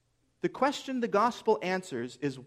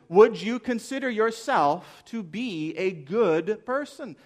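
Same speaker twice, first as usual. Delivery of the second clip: slow, louder question in own accent; slow, deliberate question; 3 semitones higher, 4 dB louder, slower than usual. Real, not acted. Preacher putting the question to the congregation, slow and deliberate. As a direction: draw the question out emphatically, pitch climbing at the end.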